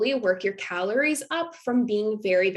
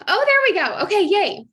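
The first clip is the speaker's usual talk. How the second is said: much higher and louder happy relief; bright relief as it finally works; relieved yay; 10 semitones higher, 7 dB louder than usual. Real, not acted. Presenter to viewers as the picture finally loads, relieved cheer.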